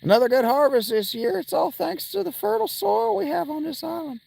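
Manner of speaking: high put-on farmer twang; a proud hill farmer bragging about his soil; silly country voice. Farmer villager talking about the harvest, in a high silly twang. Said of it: "where I practice the silly voices".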